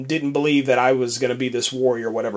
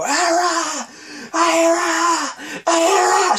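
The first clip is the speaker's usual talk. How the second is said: screaming a name; much slower than usual. Retold or imitated. Woman searching for the hidden girl, screaming her name. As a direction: call out desperately across the hills. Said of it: "she starts screaming".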